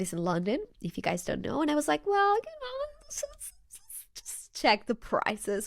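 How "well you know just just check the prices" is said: very high, drawn-out mock-casual squeak; slow falsetto, talking yourself into it; coy self-persuasion. Fan justifying a look at ticket prices, coyly high.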